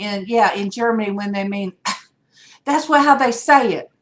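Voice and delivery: usual speaking voice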